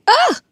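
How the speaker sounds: sudden high, loud yelp of fright; startled shriek. Real, not acted. Startled by a fly buzzing near her, a real high loud shriek. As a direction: jump at a fly with a sharp scream. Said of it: "It's a fly".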